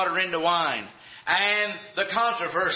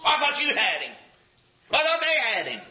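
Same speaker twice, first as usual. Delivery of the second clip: anxious parent; 9 semitones higher than usual. Acted. Parents searching for lost boy.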